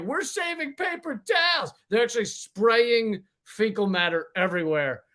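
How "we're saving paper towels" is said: high, smug corporate pitch; mock company voice. Mocking Dyson's paper-towel excuse in a high smug voice. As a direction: play the proud hand dryer makers. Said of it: "they were like".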